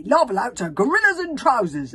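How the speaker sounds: high, loud, affronted; indignant protest. A narrator's reading. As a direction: scoff loudly in outrage. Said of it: "protested the man indignantly".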